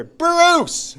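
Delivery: high shouted call of a name across the street. Retold or imitated. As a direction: yell his name from afar to get attention. Marty calling out across street.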